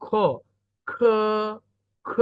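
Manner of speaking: long aspirated খ; Pinyin k as খ. Shown as a demonstration. Teacher models k for the class, drawn-out breath-released খ. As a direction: puff air into a held খ. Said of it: "কে এর উচ্চারণ হবে খ".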